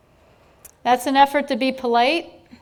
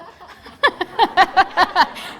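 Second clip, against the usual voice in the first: real laugh; 7 semitones higher, 6 dB louder than usual. Real, not acted. Presenter laughs at her story about her son, loud laugh.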